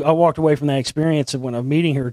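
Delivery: normal talking voice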